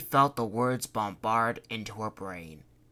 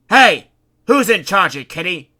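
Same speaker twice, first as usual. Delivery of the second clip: higher and much louder demand; 7 semitones higher, 18 dB louder than usual. Acted. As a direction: put her in her place. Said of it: "he demanded".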